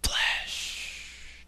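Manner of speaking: ordinary talk, nothing marked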